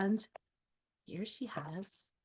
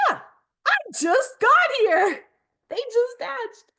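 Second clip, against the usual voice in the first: 1 flustered; 11 semitones higher, 9 dB louder, much slower than usual. Acted. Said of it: "Snap says".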